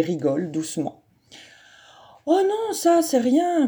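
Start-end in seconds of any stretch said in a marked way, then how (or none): 2.3-3.7 put-on higher, easygoing boy shrugging it off; a schoolboy brushing off a bump; light boy voice. A narrator's reading. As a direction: toss it off lightly, up high. Hippolyte laughs it off.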